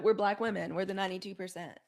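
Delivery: usual speaking voice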